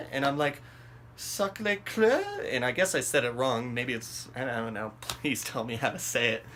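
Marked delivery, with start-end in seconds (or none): 1.2-2.2 slow, high, questioning attempt; unsure repeat. Repeating it for the confused French driver, unsure question. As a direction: repeat the foreign name slowly, rising at the end.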